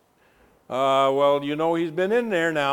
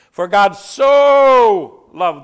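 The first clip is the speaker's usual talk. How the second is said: "so" loud, higher and slowed; shouted; 5 semitones higher, 13 dB louder, much slower than usual. Shown as a demonstration. Preacher booming John three sixteen. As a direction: proclaim "For God so loved the world" at full voice. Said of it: "I kind of stretched the word so".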